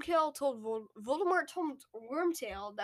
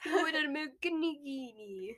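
put-on Italian accent; 3 semitones higher than usual. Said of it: "in an Italian accent".

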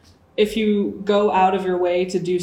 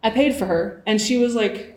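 ordinary talk, nothing marked

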